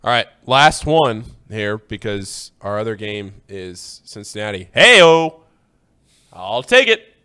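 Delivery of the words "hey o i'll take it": loud happy whoop; excited cheer. Real, not acted. Celebrating his team's game.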